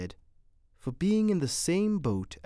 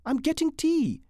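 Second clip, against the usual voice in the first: high, louder call back; shouted reply; 5 dB louder than usual. A narrator's reading. Woman getting tea calls back, shouted reply. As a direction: call back that you are busy. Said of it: "she shouted".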